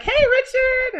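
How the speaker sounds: high, loud call-out; very high-pitched mock greeting; imitated cheery greeting. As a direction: imitate her calling out brightly. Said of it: "She's like".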